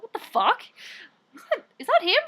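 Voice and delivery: high, loud disbelieving reaction; retold startled outburst; mock alarm. Retelling alarm at being followed.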